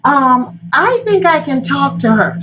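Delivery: a hint of a young girl volunteering; lightly voiced young Oshun; youngest Orisha. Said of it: "she's like".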